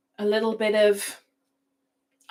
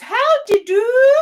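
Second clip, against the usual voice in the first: very high, much louder put-on "How do you do?"; hammed-up shrill-free high greeting, no accent; mock greeting; 11 dB louder than usual. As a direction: ham up a prim little greeting in a different voice. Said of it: "she does it in her southern accent".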